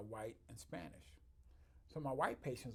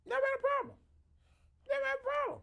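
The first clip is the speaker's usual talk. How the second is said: emphatic; 9 dB louder, faster than usual. Real, not acted. Emphatic repeated boast.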